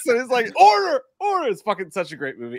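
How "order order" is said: much higher, louder call to order; hammed-up judge; mock judge. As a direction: bang the gavel with your voice. Judge in the retold scene.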